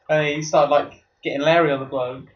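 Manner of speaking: usual speaking voice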